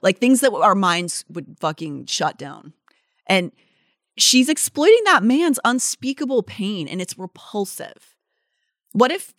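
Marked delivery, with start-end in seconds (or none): none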